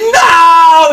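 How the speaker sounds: high, loud cry of No!; a fan's loud, high wail of disbelief; anguished shout. Retold or imitated. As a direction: cry out like your show just got cut. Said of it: "I was like".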